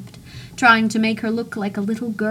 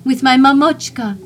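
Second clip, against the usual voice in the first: much louder, higher fond shout; 5 semitones higher, 9 dB louder than usual. Acted. Narrator shouts about his mother, delighted. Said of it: "I shouted".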